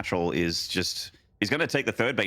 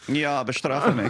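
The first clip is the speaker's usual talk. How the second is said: suggestive German; 5 semitones higher, slower than usual. Pretend. Caster jokes to his co-caster in bad German, slow and suggestive. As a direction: ham up a cheeky suggestive line in bad German. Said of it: "terrible German with terrible, uh, terrible phrases said in a very, uh, suggestive way".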